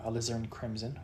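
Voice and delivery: ordinary talk, nothing marked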